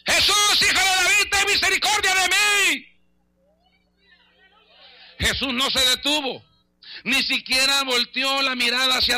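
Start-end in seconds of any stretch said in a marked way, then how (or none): 0.1-2.9 high, long-drawn shouted plea; full-voiced cry for mercy, pitched way up. Canaanite woman cries after Jesus, desperate shout. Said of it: "yo voy a gritar".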